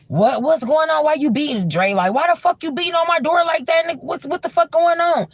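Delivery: high, annoyed and loud; irritated drunk host. Retold or imitated. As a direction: confront the strangers at your door. Drunk Suki answering the door.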